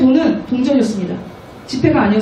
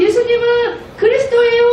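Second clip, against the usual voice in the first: put-on higher, bright child's declaration; children's proclamation; 9 semitones higher than usual. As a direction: announce it like a child sure of it. Children confessing Christ.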